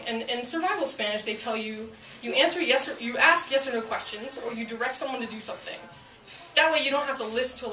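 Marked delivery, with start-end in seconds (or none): none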